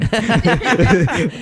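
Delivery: loud, high burst of laughter; big laugh. Real, not acted. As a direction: let a big delighted laugh out. Gushing about the couple.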